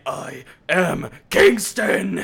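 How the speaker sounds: loud, slow hero proclamation; grand hero declaration. Retold or imitated. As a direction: boom it like a caped crusader. Parody of I am Batman.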